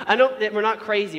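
ordinary talk

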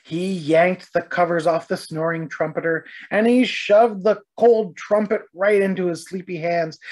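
plain reading voice

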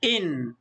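إن as "in" with a light held n; isolated إن closed on a humming n; kasra tanween. Shown as a demonstration. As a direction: let the nun linger at the end of إن. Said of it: "نضيف لها نون ساكنة خفيفة فنقول".

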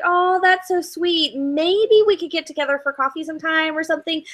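mock-sweet girl turning down a date; playing the woman letting a suitor down gently; polite brush-off. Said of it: "blowing him off".

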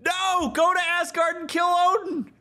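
high, loud shouted demand; excited shout. Retold or imitated. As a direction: yell him on toward Asgard. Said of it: "we immediately go".

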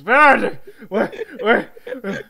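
loud, high startled yelling; panicked. Acted. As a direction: wake up shouting in a panic. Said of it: "You see Kyo panic, and he goes".

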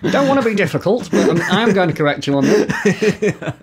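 high, mock-reluctant correction; playful pedantry. Speaker jokingly correcting someone's English, playful pedantry. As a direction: correct them with playful fussiness, a laugh creeping in.

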